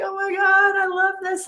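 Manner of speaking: high, delighted gush; overjoyed. Retold or imitated. Retelling her reaction to a client video, high delighted gush. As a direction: squeal with joy at the message. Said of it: "I was just like".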